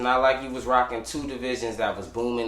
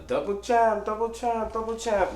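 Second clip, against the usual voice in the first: mocking voice; 11 semitones higher than usual. Mocking Nunes's title talk.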